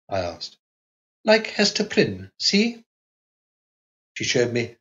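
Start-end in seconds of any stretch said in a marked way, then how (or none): 1.2-2.8 put-on high, light young wife's voice; Carlotta's girlish voice. A narrator's reading. Narrator voicing the young wife to her husband, high and innocent. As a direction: lift into a childlike wife asking innocently.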